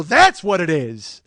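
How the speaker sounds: loud, slow, drawn-out exclamation; triumphant shout. Retold or imitated. Contestant shows off his ten grand, triumphant shout. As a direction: proclaim it loudly, stretching the words. Re-enacts celebrating with the host.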